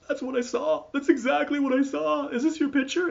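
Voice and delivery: very high, put-on tearful voice; mock a tearful woman in high falsetto; imitating crying woman. Tearful woman recognizing the Sasquatch picture, imitated in high voice. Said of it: "she started crying".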